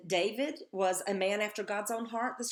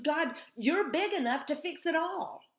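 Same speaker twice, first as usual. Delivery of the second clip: letting God have it; 7 semitones higher than usual. Retold or imitated. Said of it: "he lets God have it".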